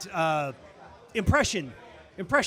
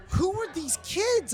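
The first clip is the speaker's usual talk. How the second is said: bewildered; 10 semitones higher, slower than usual. Retold or imitated. Confused quote of own thought.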